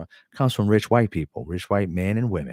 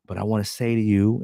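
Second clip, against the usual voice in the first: usual speaking voice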